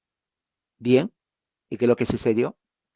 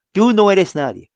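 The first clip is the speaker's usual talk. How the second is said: slow, higher and louder put-down; a Hebrew rebuffing Moses with loud scorn; scornful rebuke; 8 semitones higher, 5 dB louder than usual. Retold or imitated. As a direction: spit out the insult to his face. Hostile Hebrew rejecting Moses.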